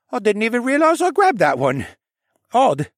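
put-on high, loud puzzled boy voice. Boy puzzles over the saddle to Alex, high and loud. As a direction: wonder at it aloud.

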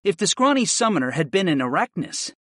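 ordinary delivery

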